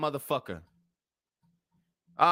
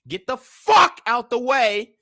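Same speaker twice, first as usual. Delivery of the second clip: louder, slow and forceful; loud demand; 7 semitones higher, 6 dB louder than usual. Real, not acted. Streamer ordering the clip speaker aside, loud and slow. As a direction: bark it out, fed up.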